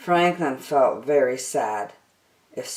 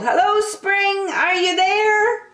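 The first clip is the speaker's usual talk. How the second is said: calling out. Acted. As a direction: call around the corner hopefully.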